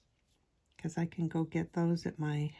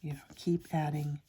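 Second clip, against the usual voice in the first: ASMR whisper. Real, not acted.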